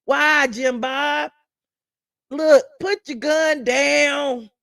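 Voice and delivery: slow, drawn-out mock shout of a gently begging officer; pleading cop. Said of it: "Got his hand on the shoulder".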